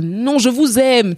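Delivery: put-on joking Cameroonian-accented call to a crowd, drawn out; high, slow, hammed-up Cameroonian accent. Singer joking as if addressing her audience, Cameroonian accent. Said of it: "je commence à parler au public".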